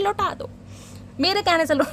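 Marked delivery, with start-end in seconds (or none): none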